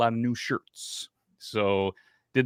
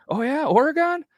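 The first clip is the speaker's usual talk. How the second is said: high, rising surprised Oregon?; surprised question; 4 dB louder than usual. Retold or imitated. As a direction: echo it back much higher in surprise. Clerk repeating Oregon surprised.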